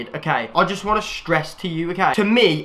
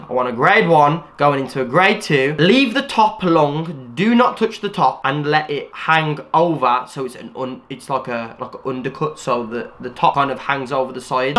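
normal talking voice

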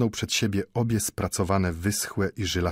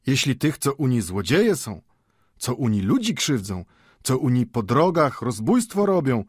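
lightly voiced jovial old man, raised pitch; sing-song, good-humoured protest in a higher voice; jovial good nature; 6 semitones higher, 4 dB louder than usual. A narrator's reading. Old Mendel covers his alarm before the watchmaker, jovial good nature. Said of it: "przybrawszy ton jowialnej dobroduszności".

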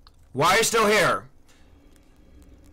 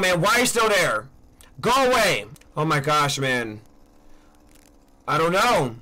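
ordinary talk

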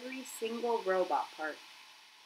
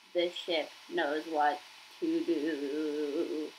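slow, low, stuttering broken robot; glitching dying machine voice; garbled robot voice; 3 semitones lower, slower than usual. Acted. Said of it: "spoke in a quiet, garbled voice".

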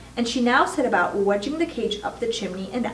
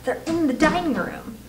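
ordinary delivery

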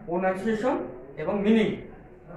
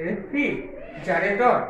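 Refrigerator said very slowly, syllable by syllable; slow broken-up pronunciation; much slower than usual. Shown as a demonstration. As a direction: stretch out refrigerator into slow separate syllables for pupils. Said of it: "এইভাবে ভেঙে ভেঙে আস্তে আস্তে উচ্চারণ করবে".

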